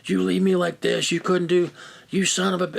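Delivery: high-pitched mock tirade; retold screaming in a strained high voice; imitated angry yelling. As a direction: imitate a furious caller ranting down the phone. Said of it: "he's yelling and screaming".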